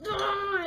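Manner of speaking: loud, high exasperated groan; a parent's fed-up ugh; hammed-up loud groan; frustrated groan. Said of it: "they're like".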